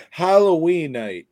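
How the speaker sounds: HALLOWEEN NIGHT announced high and loud as one word; run together as one word. Shown as a demonstration. Recommending a movie title to co-host, run together as one word. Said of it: "all one word".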